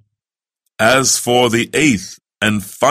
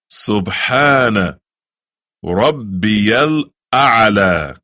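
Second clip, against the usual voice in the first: slow recitation; much slower than usual. Shown as a demonstration. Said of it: "Try it".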